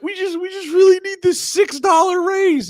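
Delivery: high, loud, whining fake sob; mock whiny pleading. Mocking union workers begging for a raise, high whine. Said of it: "they start crying and they're like".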